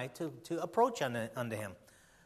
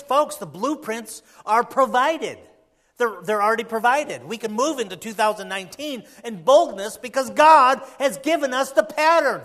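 loud, raised, urgent sermon voice; full-force preaching; 6 semitones higher, 12 dB louder than usual. Real, not acted. Preacher to church about facing the new year, full-force preaching. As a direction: preach it out hard to the congregation.

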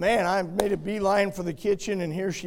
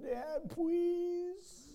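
put-on little boy begging; 11 semitones higher, 8 dB quieter than usual. Toddler son begging.

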